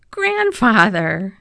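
drawn-out, slow shocked cry of the name; shocked reproach. Acted. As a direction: call out in disbelief, slowly. Boy shocked at grandfather's fear.

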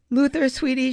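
high, sweet call to a dog; loving call. A narrator's reading. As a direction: coax the dog tenderly. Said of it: "she called in a loving tone".

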